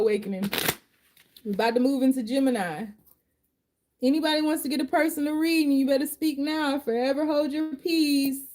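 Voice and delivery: ordinary talk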